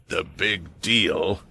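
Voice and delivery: low, slow menacing growl; dangerous growl. A narrator's reading. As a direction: drop into a threatening growl. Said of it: "his voice dropping to a dangerous growl".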